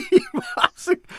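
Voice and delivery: breaking into high laughter mid-word; cracking up, can't finish; laughing. Real, not acted. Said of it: "I'm sorry".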